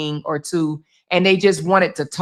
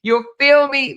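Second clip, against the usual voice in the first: higher, sassy imitation of Toya; 7 semitones higher than usual. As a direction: borrow Toya's teasing tone. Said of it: "in my Toya voice".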